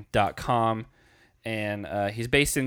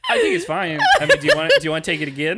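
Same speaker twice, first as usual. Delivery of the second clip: laughing; 8 dB louder, much faster than usual. Real, not acted. Laughing at the accent attempt.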